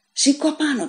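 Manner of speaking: high barked order; guard shouting. A narrator's reading. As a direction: shout him up off the floor. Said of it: "του φώναξε ο φύλακας".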